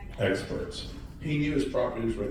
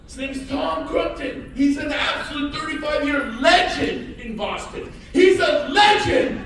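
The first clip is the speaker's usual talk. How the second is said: high, loud hype building to a shout; hype introduction; 14 semitones higher, 9 dB louder, slower than usual. Acted. Speaker introducing his partner Tom to clients, hyped shout. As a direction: sell the legend with a roar.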